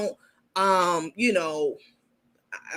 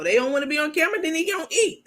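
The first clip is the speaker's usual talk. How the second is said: her harsher later version, higher and blunt; mild mocking of the blunt threat; higher mock cold host; 7 semitones higher, faster than usual. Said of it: "I showed you the new video".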